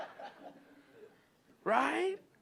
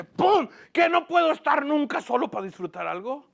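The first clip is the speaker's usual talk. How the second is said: mock outburst, the bang pitched high; hammed-up grumpy pastor slamming his tray; annoyed pastor; 6 semitones higher than usual. Rude pastor snapping at a churchgoer who greeted him, mock angry.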